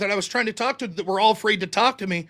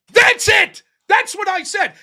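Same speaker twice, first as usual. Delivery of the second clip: high, loud indignant shout; a real indignant shout at the end of the story; outraged shout; 8 semitones higher, 6 dB louder, slower than usual. Real, not acted. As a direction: yell it like the punchline of your outrage. Outraged punchline of the rant.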